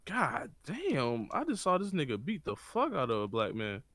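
ordinary talk, nothing marked

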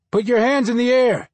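loud, high barked order; booming police command. Acted. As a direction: order him to surrender from afar. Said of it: "a giant voice".

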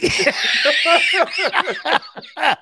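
big laugh at his own joke; loud high laugh. Real, not acted.

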